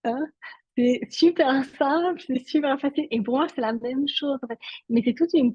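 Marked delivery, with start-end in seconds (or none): 0.0-3.1 playfully imitating the audience scoffing that it's easy; mock breezy dismissal. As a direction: brush it off like it's nothing. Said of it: "vous allez me dire".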